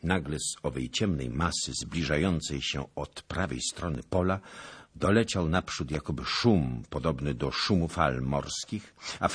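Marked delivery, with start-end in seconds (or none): none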